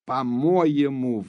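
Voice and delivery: slow, heavy falling fury; angry near-shout. A narrator's reading. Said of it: "вдруг закричала почти Наташа".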